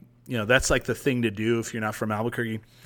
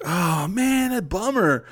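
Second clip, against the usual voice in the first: higher, put-on letdown; mock disappointment; 8 semitones higher, 3 dB louder, slower than usual. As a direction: fake sympathy, lightly hammed. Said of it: "we're like".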